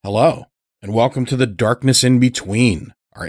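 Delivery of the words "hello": ordinary delivery, nothing marked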